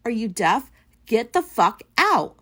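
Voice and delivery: loud, slow, angry order to leave; retold yelling. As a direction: throw her out of the office, loud and slow. Retelling shouting at her supervisor.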